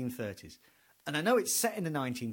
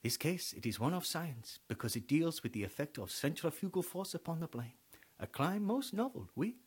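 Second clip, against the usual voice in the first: Poirot; 4 dB quieter than usual. Retold or imitated. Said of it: "Poirot would go".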